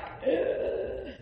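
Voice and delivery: re-enacted sharp gasp of shock. Woman acting out reading the man's charges, shocked gasp. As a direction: gasp as if reading something awful.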